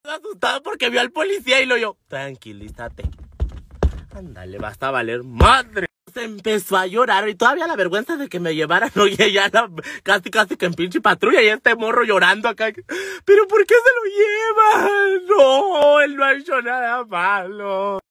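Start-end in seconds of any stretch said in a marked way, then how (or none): 2.1-5.9 a low, slow hush to calm him down; slow him down with a low, steady voice. Retold or imitated. To her scared boyfriend in the police car, low and slow hush. Narrator hushing panicking boyfriend. 13.2-18.0 high, whiny shouted plea, drawn out; mock crying protest. Boyfriend begging police not to take her, mock wailing.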